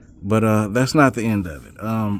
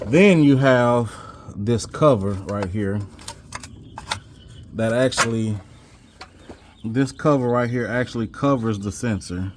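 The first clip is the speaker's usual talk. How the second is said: ordinary talk, nothing marked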